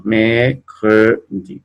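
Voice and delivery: mercredi stretched out, each syllable separate; very slow syllable by syllable. Shown as a demonstration. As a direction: spell out mercredi slowly by sound. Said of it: "¿no?".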